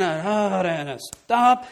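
normal talking voice

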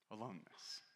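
quiet, heavy repeat of Aloneness. Real, not acted. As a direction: let Aloneness drop softly and gravely. Repeating the word for weight.

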